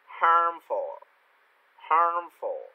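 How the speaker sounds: "harmful" with the tongue curled after the a. Shown as a demonstration. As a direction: model "harmful" clearly, curling into the r. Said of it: "cố gắng cong lưỡi cho thầy". (đọc "harmful" cong lưỡi ở âm ar)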